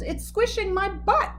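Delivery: high whiny grumble; grumbling complaint. A narrator's reading. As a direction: groan it out. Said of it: "He groaned and he grumbled".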